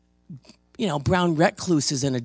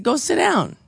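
higher, louder order; firm command; 10 semitones higher, 6 dB louder than usual. Retold or imitated. Preacher dismissing the demanding lady. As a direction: send her back to her seat. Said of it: "I said".

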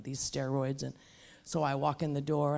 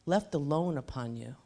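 ordinary talk

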